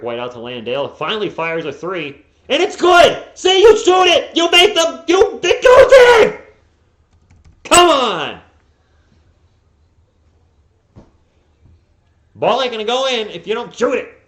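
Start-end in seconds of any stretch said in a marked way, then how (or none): 2.5-3.2 elated high shout; excited shout. Real, not acted. Fan celebrating a made three on stream, excited shout. Three-pointer finally goes in. 3.4-14.2 exasperated high yelling; frustrated shouting. Real, not acted. Fan scolding the Spurs for not shooting, frustrated shout. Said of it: "I'm just frustrated".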